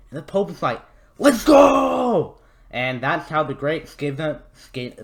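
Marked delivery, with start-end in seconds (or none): 1.2-2.4 high, drawn-out hype yell; excited shout. Acted. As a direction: shout it out, pitched way up. Pope's delighted reply, acted out.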